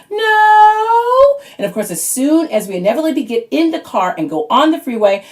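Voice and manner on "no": put-on high, loud child's flat refusal; one-word kid refusal, pitched high; bratty kid no. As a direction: snap no like a stubborn little kid. Kids refuse bathroom before trip.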